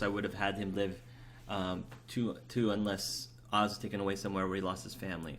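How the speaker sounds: usual speaking voice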